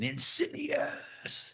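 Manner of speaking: very high, drawn-out mock horror shriek; screeching title sting. Podcaster imitating the red title hitting the screen, drawn-out screech. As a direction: stretch it out slowly in a high, voiceless screech.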